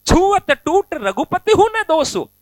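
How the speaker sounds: high, slow needling taunt; mocking tease. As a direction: goad the angry sage with a lilt. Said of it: "ये और चिढ़ा रहे हैं".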